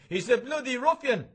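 put-on a drunk roaring his protest across the bar; drunken shout. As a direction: bellow it out like an angry drunk in a pub. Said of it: "Bob Doran shouts out of him".

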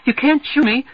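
high, loud frightened scream. Acted. Girl screaming at Bodine's pistol, high loud scream. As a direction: scream it at the gun. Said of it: "she screams".

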